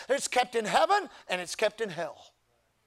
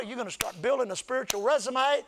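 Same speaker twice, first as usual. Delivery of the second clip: usual speaking voice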